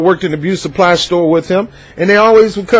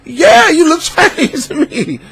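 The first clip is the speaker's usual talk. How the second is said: laughing reply; 11 semitones higher than usual. Retold or imitated. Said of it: "My man said".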